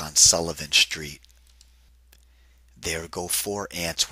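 even, lilting storybook read; reading to children. A narrator's reading. Narrator reading a picnic rhyme to kids, lilting storybook voice. Reads picture book aloud.

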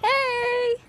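excited greeting. Real, not acted. Friend greets camera excitedly.

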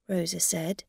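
ordinary delivery

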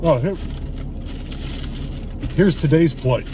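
usual speaking voice

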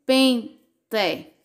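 "Pente" split into Pen, te; "Pente" with a pause after Pen; syllable by syllable. Shown as a demonstration. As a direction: sound out "Pente" in two beats, with a hard final te. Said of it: "E quantas sílabas?".

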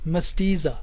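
"mestiza" with stress on the middle "ti"; primary stress demo. Shown as a demonstration. Teacher models the second word, stressing its middle syllable. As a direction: say "mestiza" deliberately, leaning on "ti". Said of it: "the primary stress".

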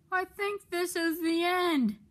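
high dramatic exclamation; high-pitched toy engine exclaiming. Acted. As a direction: cry it out like the end has come. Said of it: "exclaimed Thomas".

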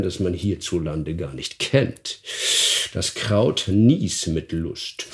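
the stranger taunting with a secret; sly stranger. Acted. Guest taunts the dwarf, low and sly.

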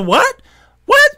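lightly voiced disbelief; shocked. Friend reacting in disbelief to advice, surprised. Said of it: "He said".

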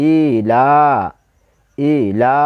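short ই, then লা drawn right out; one-alif elongation. Shown as a demonstration. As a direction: hold the long "aa" in ই লা. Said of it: "খাড়া জবর আছে আগে টানতে হবে".

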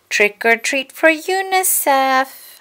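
high, slow, singsong chant; trick-or-treaters calling. Acted. Children at Corduroy's door asking for treats, singsong call.

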